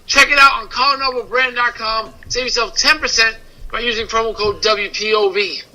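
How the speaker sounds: ad read. Acted. Host reading a sponsor spot to listeners, soft ad read. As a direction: sell the shirts like a host ad.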